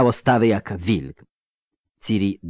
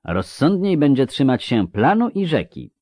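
raised, assured pronouncement; know-it-all tone; 7 semitones higher, 4 dB louder than usual. A narrator's reading. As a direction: lecture them as if you know best. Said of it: "wszystko wiedzącym tonem Regis". (wszystkowiedzącym tonem)

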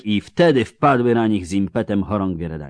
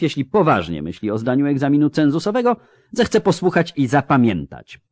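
higher-pitched, emphatic reprimand; stern military examiner; 5 semitones higher, 4 dB louder than usual. Acted. Captain correcting a cadet before the class, stern reprimand. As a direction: lecture the cadet with official indignation.

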